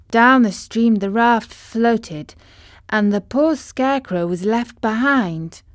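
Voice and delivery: ordinary delivery, nothing marked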